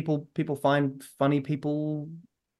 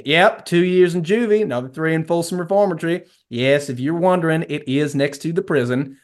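put-on heavy American South accent; 2 semitones higher, 3 dB louder than usual. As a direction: do a terrible Texan accent. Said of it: "Dean, in a heavy American South accent".

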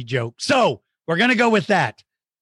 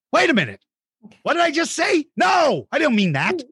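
mock panic; 9 semitones higher than usual. Realizes he admitted losing.

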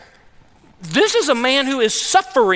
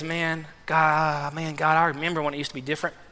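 much softer, rushed, aching memory; wistful remembering; 7 semitones lower, 9 dB quieter than usual. Acted. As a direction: pour it out quietly and fast. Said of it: "He says".

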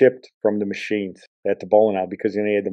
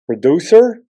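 louder, much higher call across the studio; calling out to the producer; 8 semitones higher, 8 dB louder than usual. Real, not acted. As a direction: hail the producer with a playful question. Host calls out to producer.

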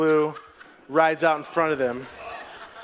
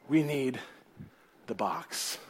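hushed; 5 semitones lower, 9 dB quieter, slower than usual. Acted. Preacher hushes on the key phrase.

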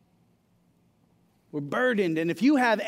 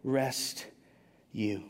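very slow, much lower and softer; gentle Jesus; 7 semitones lower, 6 dB quieter, much slower than usual. Acted. Preacher voicing Jesus' invitation, slow and soft. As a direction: lay each word down slowly and tenderly. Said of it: "And Jesus says".

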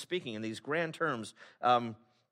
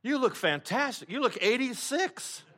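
high, exaggerated fake compliment, voice pitched way up; mock amazement; 9 semitones higher, 4 dB louder, slower than usual. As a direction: gush over the old man with overdone awe. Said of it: "you're like".

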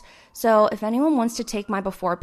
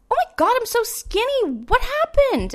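high, shocked exclamation; 13 semitones higher, 4 dB louder than usual. Retold or imitated. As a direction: gasp at how thin you were. Quotes her own shocked reaction.

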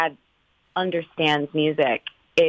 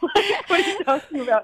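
much higher, incredulous mock; mock disbelief; 14 semitones higher than usual. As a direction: toss it off in playful disbelief. Brother mocking dad's remark.

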